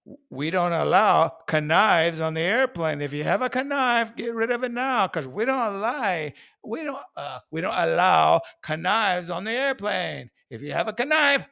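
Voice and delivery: lightly voiced airline announcement; mock announcement. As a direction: announce it like airline staff, hammed up. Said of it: "Or how about this?".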